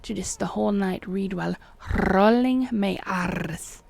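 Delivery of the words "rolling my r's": slow, drawn-out trill on "rolling" and "R's"; exaggerated rolling R's in "rolling my R's"; rolled R's. Shown as a demonstration. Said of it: "read the whole book like that".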